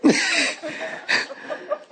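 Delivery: high breathless laugh; laughing at his own joke. Real, not acted. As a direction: let a wheezy laugh out. Laughs after the alarm.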